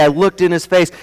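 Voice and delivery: normal talking voice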